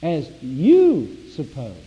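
slow phrase with a big swell on "you"; stress on YOU. Shown as a demonstration. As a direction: hit "you" hard, then drop on "suppose". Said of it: "I put a little emphasis there".

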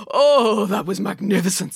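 blissful awe. A narrator's reading. The king has finished the whole cake, blissful. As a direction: swoon over it with a big opening oh.

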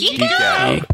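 very high sign-off squeal. Real, not acted. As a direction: squeal the catchphrase. Sign-off catchphrase.

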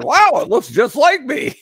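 high, loud gushing wow; hammed-up thrilled exclamation; mock amazement. Mock quote of amazed figure buyers.

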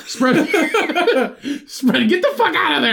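incredulous laugh while reading. Real, not acted. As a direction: crack up mid-sentence and push the words out. Laughs at the word spread.

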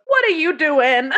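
high, loud quote through laughter; cracking up while quoting; laughing quote. Retold or imitated.

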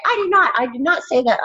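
high, mock defensive mom denial; mom denying. Daughter imitating her mom insisting she never said it, high and defensive. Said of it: "And she's like".

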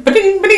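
high, loud "ding" mimicking text alerts; phone alert imitation. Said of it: "I can only picture, like".

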